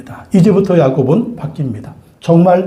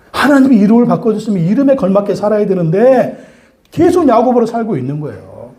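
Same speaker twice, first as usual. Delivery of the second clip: ordinary talk, nothing marked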